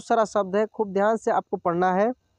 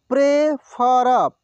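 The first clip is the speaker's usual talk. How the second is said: Prefer drawn out slowly, syllable by syllable; slow sounding out; 3 dB louder, much slower than usual. Shown as a demonstration. Teacher slowly sounds out prefer for students. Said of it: "एक एक मात्रा को अलग अलग करके".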